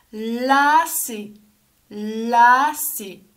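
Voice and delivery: long, loud first syllable; stretched-out word. Shown as a demonstration. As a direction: draw the word out for young learners. Teacher models word for children.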